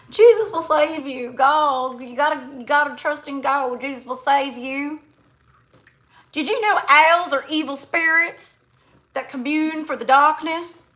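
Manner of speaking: high-pitched Southern preacher parody; mock Southern accent. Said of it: "my southern accent too".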